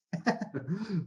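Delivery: a short high chuckle before the punchline; amused chuckle. Retold or imitated. Thornycroft chuckling at the Navy's objection, amused. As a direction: laugh at the Admiralty's complaint.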